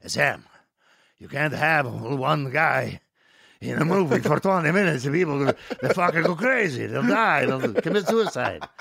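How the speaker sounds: agitated producer impression, slow and drawn out; nervous Dino De Laurentiis. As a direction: imitate a fretting film producer pleading his case. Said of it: "Dino got extremely nervous".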